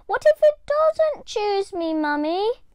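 put-on high small child's voice, anxious; a little girl fretting to her mum; worried little girl. A narrator's reading. Little Diane worried she won't be chosen. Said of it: "started to feel a bit sad".

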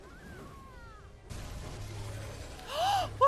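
long gasp rising into a cry; startled gasp. Real, not acted. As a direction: suck in air in shock. Jump scare in the game.

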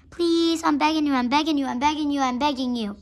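loud, run-on pleading, easing down in pitch; desperate begging. Acted. Begging Sugar to take a place in the stall, loud pleading. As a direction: plead over and over without letting up.

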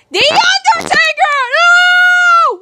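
loud, high surprised yell; shocked shout. Acted. Kid seeing the surprise figure, shocked shout. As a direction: cry out in disbelief at the reveal.

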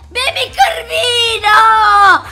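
excited greeting. Real, not acted. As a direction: greet the new little friend with stretched, shouted delight. Saluta il cucciolo appena nato. (saluto entusiasta, forte e allungato)

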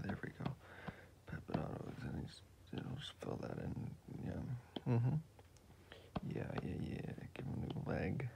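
soft, breathy mumbling while drawing; quiet murmur. Real, not acted. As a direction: murmur to yourself, half whispered. Whispering while he draws.

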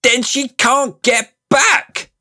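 loud, high, slow outraged shout; shouting boy. Acted. Nephew shouting at his cruel uncle, outraged. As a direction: yell it at the uncle. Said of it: "shouted Diggory".

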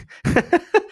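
high burst of laughter mid-question; sudden high-pitched giggling fit; real laugh. Real, not acted. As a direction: break up laughing while reading. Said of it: "Sorry, I had to laugh there".

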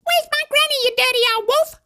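angry. Acted. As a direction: rant at the wolf indignantly. Hoodie telling off wolf.

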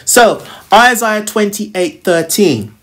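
ordinary delivery

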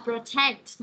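"protect" drawn out as pro-TECT; slow "protect", weight on the second syllable; stress on second syllable. Shown as a demonstration. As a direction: correct with a punched TECT in "protect". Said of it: "nhấn hai, đọc lại".

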